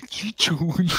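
lightly imitating a high anime voice; vtuber. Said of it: "tiene voz anime".

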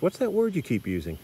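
high-pitched mock query from the class; puzzled students. Class questioning their foreign teacher, puzzled high imitation. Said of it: "my class said to me".

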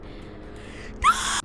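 breathy shocked gasp; sudden gasp at what she sees; sharp gasp. Real, not acted. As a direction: gasp in alarm. Sees the farm.

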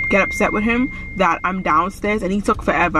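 ordinary talk, nothing marked

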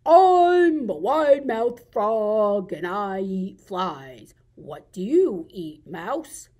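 slow, stretched frog voice, loud at the start; wide-mouthed frog. Acted. Frog introduces himself to a mouse, drawn-out frog voice. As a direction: drawl the introduction, then ask. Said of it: "this is called the wide-mouthed frog".

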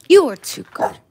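very high, loud squeaky coo. Real, not acted. Owner gushing over her cute dog in a high squeal.

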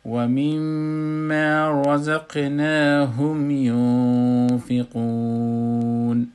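slow, high, loud chanted tajweed recitation; Quranic recitation. Shown as a demonstration. Teacher reciting al-Baqarah verses to show students the madd. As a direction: intone each verse slowly with long held madd.

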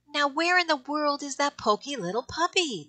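high, puzzled puppy voice. Acted. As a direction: wonder aloud like a small puppy. Puppies wonder, quoted aloud.

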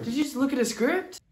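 high-pitched accusing question. Acted. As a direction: call them out in a high voice. Accusing the other character.

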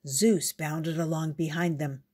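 usual speaking voice

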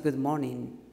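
low, unlifted good morning; low-toned greeting. Shown as a demonstration. Coach shows the wrong presentation opening, low tone. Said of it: "with this low tone".

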